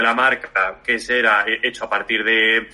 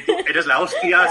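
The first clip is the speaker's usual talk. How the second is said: slowed, higher hype-man line, half laughing; a playful coach cheering a runner on; mock pep talk; 5 semitones higher, slower than usual. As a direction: pump up a model backstage, laughing at yourself. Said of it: "speech, eh, motivacional".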